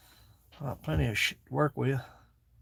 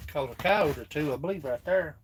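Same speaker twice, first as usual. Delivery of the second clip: normal talking voice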